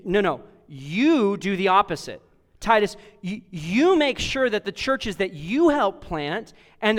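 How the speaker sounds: acted urgent correction, rapid "No, no, no" and a leaned-on "you"; emphatic charge. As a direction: insist firmly, punching every "you" and the lone "No". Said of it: "That's the emphasis that Paul's putting here".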